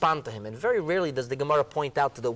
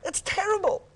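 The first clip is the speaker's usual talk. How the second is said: high-pitched, aghast outcry; distressed protest; 10 semitones higher than usual. Retold or imitated. Sages mourn the fox on holy ground, aghast. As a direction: build the outrage to a high peak.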